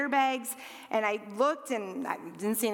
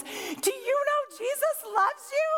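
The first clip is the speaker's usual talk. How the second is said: high, slow, imploring imitation; fervent believer; 12 semitones higher than usual. Stranger down in Kate's face, fervently imploring. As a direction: ask it slowly, pitched way up. Woman gets in child's face.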